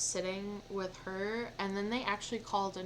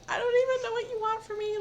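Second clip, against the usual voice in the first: much higher and faster, frantic plea; upset near tears; 13 semitones higher, 5 dB louder, faster than usual. Retold or imitated. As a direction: blurt it out high and fast like you're about to cry. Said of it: "I'm like".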